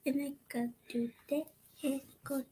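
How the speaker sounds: hushed secret whisper. Real, not acted. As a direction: breathe it out fast.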